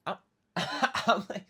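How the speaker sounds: laughing while trying to speak. Real, not acted. Server laughing retelling the rude customer. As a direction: laugh through the words.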